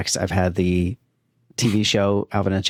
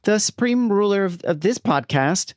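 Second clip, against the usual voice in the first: bad child actor; 9 semitones higher than usual. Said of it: "Just a bad child actor".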